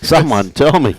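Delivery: hammed-up helpless player, laughing; mock panicked player. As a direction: play the stumped bowler begging for help through a laugh. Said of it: "Adam's gonna go and call a friend".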